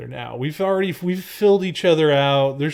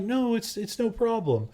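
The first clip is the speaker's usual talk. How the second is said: high, softened, self-effacing imitation; mock-meek reassurance; 8 semitones higher, 5 dB quieter than usual. Girlfriend brushing it off in her version, mock-meek. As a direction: play the blameless martyr lightly.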